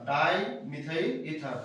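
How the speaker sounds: Dimethyl ether said slowly, syllables stretched; slow dictation repeat. Shown as a demonstration. Teacher slowing down the name for students to note. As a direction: spell out dimethyl ether at half speed. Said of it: "তাহলে dimethyl ether".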